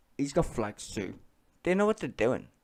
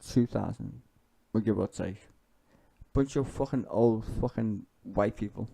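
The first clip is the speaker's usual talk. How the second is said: ordinary talk, nothing marked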